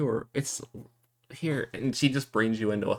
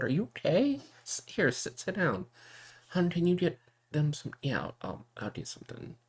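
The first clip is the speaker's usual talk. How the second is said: higher, hurried, trailing to a hush; anxious fussing; 8 semitones higher than usual. Acted. Sister sitting her brother down, anxious and fussing. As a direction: fuss and settle him down.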